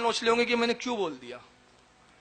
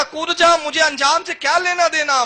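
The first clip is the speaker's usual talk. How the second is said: raised, loud order to jump; retold command shout; 7 semitones higher, 7 dB louder than usual. Slave quoting his master's command, raised and loud. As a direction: bark the order as the faithful slave. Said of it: "آپ نے کہا".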